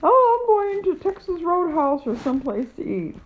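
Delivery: breezy put-on voice of the daughter, tossed off; airy daughter impression.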